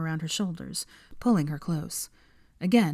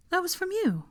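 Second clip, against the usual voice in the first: high startled question; surprised Hermione; 13 semitones higher than usual. Acted. Hermione realising the owl was from Harry, high surprise. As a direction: caught off guard.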